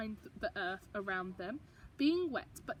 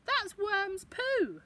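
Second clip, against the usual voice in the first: Gran explaining; 9 semitones higher, 4 dB louder, much slower than usual. Acted. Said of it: "said Gran".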